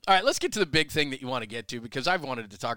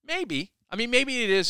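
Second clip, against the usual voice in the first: skeptical; 11 semitones higher, 3 dB louder than usual. Real, not acted. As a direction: lift it up in open doubt. Doubting a big signing.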